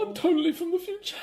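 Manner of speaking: very high, put-on goofy voice; silly mocking voice. Fan mimicking silly Thor dialogue, high silly voice. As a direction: squeak it out as a mocking imitation. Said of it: "why is he talking like that".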